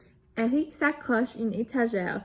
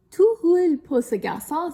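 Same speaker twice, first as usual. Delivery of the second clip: higher, louder joking exclaim; 7 semitones higher, 9 dB louder than usual. A narrator's reading. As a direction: call out teasing as the boy rolls by. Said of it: "s'exclame Steve".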